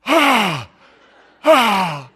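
loud, high wail of shock; horrified cry. Acted. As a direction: cry out like a panicked child. Acts out lost child's horror.

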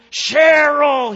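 high full-voice holler. Retold or imitated. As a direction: yell the name like an impatient, furious husband. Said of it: "he got louder and madder".

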